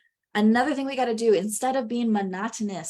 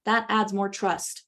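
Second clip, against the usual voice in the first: ordinary delivery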